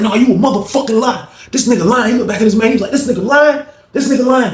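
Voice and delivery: high-pitched angry accusation; voicing a furious inmate, high and heated; angry accusing yell. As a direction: yell at him like he's lying to your face. Reggie accusing in story.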